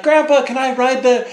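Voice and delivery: put-on high, eager little kid. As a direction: ask like a small boy. Kid asking at the store.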